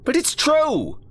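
put-on high, pleading child insisting; a boy protesting he's right; insistent kid voice.

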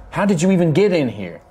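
shocked demand, voice jumps up; surprised. Acted. Knight demands how they entered.

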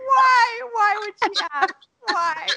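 high-pitched exasperated "why" through laughter; self-mocking disbelief; incredulous, mortified. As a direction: wail it in embarrassed disbelief, half laughing. Acted disbelief at her own oversharing.

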